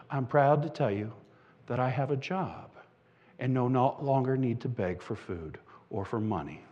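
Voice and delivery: low, hushed, sincere voice; quiet pride. Acted. Formerly begging woman telling Pam her news, hushed sincerity. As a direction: drop right down, soft and earnest.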